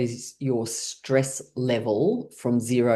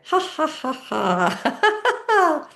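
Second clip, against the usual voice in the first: forced laughter; 13 semitones higher, much slower than usual. Shown as a demonstration. Teacher leads class in laughter exercise, high laughing. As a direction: laugh it out on purpose, pitched up high. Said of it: "we're gonna do it with laughing".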